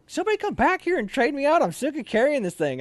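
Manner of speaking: high-pitched fed-up plea for help; retold exasperated appeal; exasperated call. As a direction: call out to the group, acted out. Acts out calling for relief.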